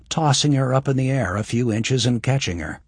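ordinary delivery

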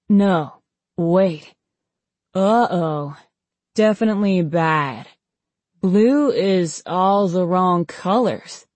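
slow, groggy, drawn-out words; woozy. Acted. Poisoned patient puzzled by his friend's colours, woozy. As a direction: drag the words out as if drugged.

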